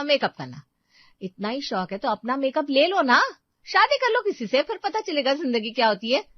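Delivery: sister-in-law gets a loud, angry telling-off; angry lecture. Acted. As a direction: tear into her for partying. Seema berates sister-in-law.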